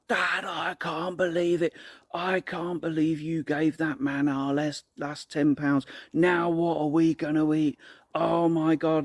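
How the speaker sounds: put-on high, slow, exasperated protest of a little girl; angry young daughter. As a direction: act out a daughter scolding her dad. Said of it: "She was so angry with me".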